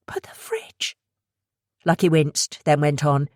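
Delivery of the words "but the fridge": high, voiceless fretful whisper. Acted. Abby whispering about the smashed fridge, voiceless. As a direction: worry it out under your breath.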